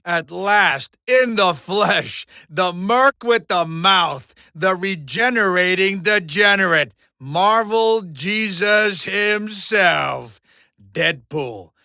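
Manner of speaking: loud, slow ring-announcer build; grand announcer introduction. Acted. Mojo introducing Deadpool to the league, grand announcer. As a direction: proclaim each title with a big drawn-out flourish.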